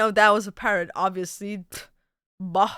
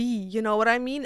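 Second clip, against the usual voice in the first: ordinary talk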